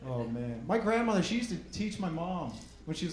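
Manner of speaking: usual speaking voice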